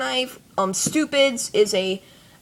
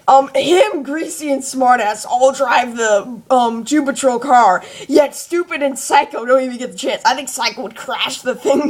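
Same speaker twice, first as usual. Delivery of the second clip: ordinary delivery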